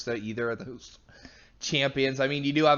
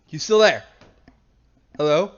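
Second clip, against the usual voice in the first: calling out; 2 semitones higher, 8 dB louder than usual. Real, not acted. Calling to a dropped co-caster.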